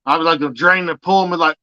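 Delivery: normal talking voice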